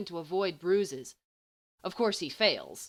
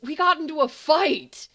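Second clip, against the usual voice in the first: higher, louder gleeful cry; excited exclaim; 9 semitones higher, 11 dB louder than usual. A narrator's reading. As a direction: burst out delighted at the idea. Gleeful about their fight.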